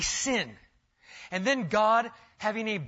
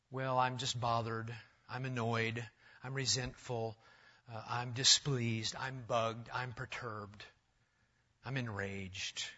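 mock muttered excuses; 6 semitones lower, 7 dB quieter, slower than usual. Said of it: "hide behind code words".

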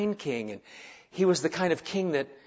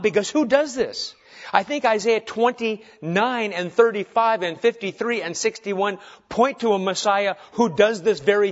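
high, emphatic sermon delivery; forceful preaching; 5 semitones higher, 6 dB louder than usual. Acted.

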